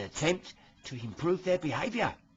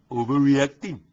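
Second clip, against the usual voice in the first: loud correction; 10 dB louder than usual. Acted. Correcting his own slip.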